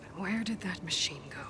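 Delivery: hushed whisper while sneaking. Acted. Hunter sneaking past a lurking machine, hushed whisper.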